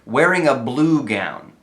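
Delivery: slow phrase leaning on "blue"; "blue" stretched as the key word; stress on BLUE. Shown as a demonstration. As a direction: draw out and accent "blue". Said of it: "because it stresses blue".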